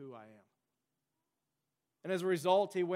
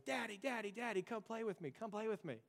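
lightly voiced small boy, pitched higher and softer; little son calling out; 5 semitones higher, 4 dB quieter than usual. Son calling across the playground to his daddy, lightly voiced child. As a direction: mimic a child begging dad to play. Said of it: "and he goes".

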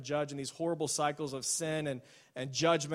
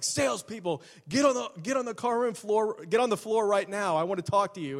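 higher-pitched mock yell over a megaphone; retold megaphone yell; 5 semitones higher, 3 dB louder than usual. Boss on the microphone calling salespeople in, mock yell. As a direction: bark the order across the lot. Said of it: "he just, like, screams it out".

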